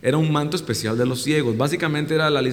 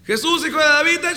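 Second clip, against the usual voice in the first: high, loud cry for help; desperate shout; 13 semitones higher, 4 dB louder than usual. Retold or imitated. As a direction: call out over the crowd. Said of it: "empezó a gritar más fuerte". (grito desesperado y agudo)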